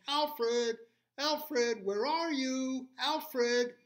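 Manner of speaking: high, loud calling. Acted. Little animals searching the forest, calling loud and high. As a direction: call across the forest.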